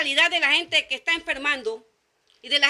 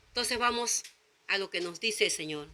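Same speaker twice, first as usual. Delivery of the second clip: normal talking voice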